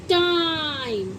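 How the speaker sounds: टाइम stretched slowly, long ai; drawn-out demo word. Shown as a demonstration. Teacher to pupils, slow drawn-out demo. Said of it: "T I M E".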